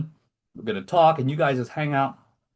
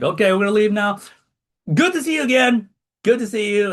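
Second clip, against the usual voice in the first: a lightly voiced, much higher forced goodbye; mock polite goodbye; 9 semitones higher, 4 dB louder than usual. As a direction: act out an awkward farewell with a touch of strain. Awkward goodbye in the shop.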